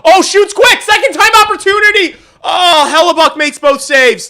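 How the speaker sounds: high, loud, laughing play-by-play; excited. Real, not acted. Streamer calling a two-on-one chance, thrilled and laughing. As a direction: call the chance breathless.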